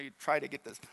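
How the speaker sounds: ordinary talk, nothing marked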